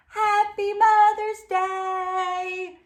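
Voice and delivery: high, slow sung-out greeting; cubs' greeting. Acted. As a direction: sing it like eager kids. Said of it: "said brother and sister".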